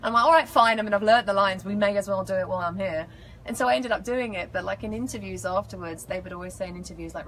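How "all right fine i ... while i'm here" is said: loud mock giving-in, easing down; pretend grudging surrender. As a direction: give in noisily, as a joke. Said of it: "I pretended to leave".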